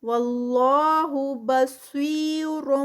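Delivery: slow, drawn-out tajweed recitation; Quranic recitation. Shown as a demonstration. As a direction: chant it slowly, stretching each sound. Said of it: "صاد heavy". (آہستہ کھینچ کر تلاوت)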